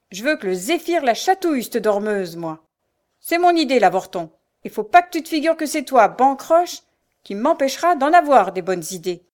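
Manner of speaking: loud, angry tirade; hateful. A narrator's reading. Mother berates her son over her cruel idea, hateful. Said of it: "des yeux pleins de haine".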